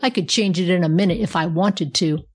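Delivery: usual speaking voice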